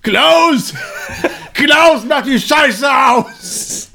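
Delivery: high loud yelling shout. Pretend. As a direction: yell across the room, laughing. Calling out to someone.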